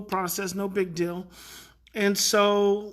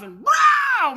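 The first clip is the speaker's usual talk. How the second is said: very high, loud mock roar; sudden wordless tantrum burst, imitated; imitated angry yell; 10 dB louder than usual. Boy's game switched off, mock raging yell. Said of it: "he's yelling and cussing".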